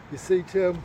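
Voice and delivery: inner voice. Retold or imitated. Inner voice to exhausted runner, measured and solemn. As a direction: let a hint of solemn inner voice speak. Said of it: "There was something inside me that said".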